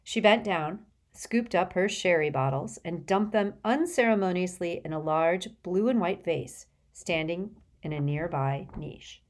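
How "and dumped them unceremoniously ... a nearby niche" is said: ordinary delivery, nothing marked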